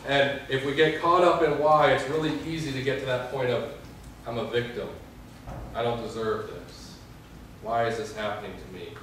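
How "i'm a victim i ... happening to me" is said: softer, lower, sorry for yourself; self-pitying murmur. Retold or imitated. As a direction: mumble it like a wallowing victim. Voicing self-pitying victim thoughts.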